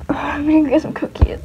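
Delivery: whispering. Acted. Owner whispering near the toys.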